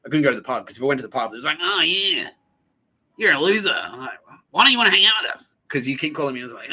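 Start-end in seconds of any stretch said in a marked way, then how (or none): 1.6-3.7 high, loud taunting imitation; mock jeering. Pub crowd taunting him, mock jeering. 4.5-5.5 high, loud, fast mock whine; mock pestering. Pub crowd demanding he join them, mock pestering.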